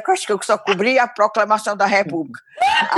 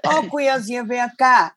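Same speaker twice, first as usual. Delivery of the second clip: meigo e carinhoso; 3 semitones higher than usual. Shown as a demonstration. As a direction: call a dear friend over tenderly. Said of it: "Aí é meigo". (chamado meigo, carinhoso)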